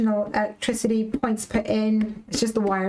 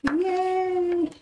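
1 cheer; 9 semitones higher than usual. Real, not acted.

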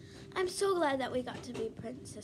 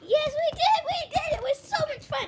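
very high, loud squealing; play-acted shrieks of delight; excited yelling; 14 semitones higher, 8 dB louder than usual. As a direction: yell it like an overexcited little princess. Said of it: "do not get too excited and yell".

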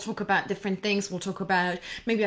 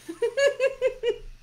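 high giggle. Real, not acted. Laughs at own word buzzing.

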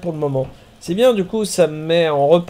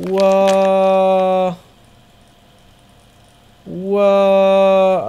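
long drawn-out wow, louder; amazed wow; 3 semitones higher, 6 dB louder than usual. Real, not acted. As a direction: marvel at the view. Émerveillé devant le tracé vertical. (émerveillement prolongé)

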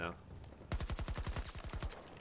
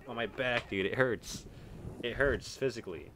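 ordinary delivery

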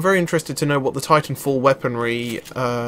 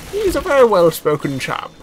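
higher, louder plummy chap; posh well-spoken impression; 8 semitones higher, 5 dB louder than usual. Shown as a demonstration. Gamer attempting an actor's voice, posh and well spoken. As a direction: put on a refined British gentleman. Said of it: "He's got a very deep, posh voice".

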